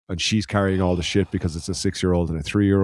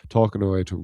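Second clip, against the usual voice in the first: ordinary talk, nothing marked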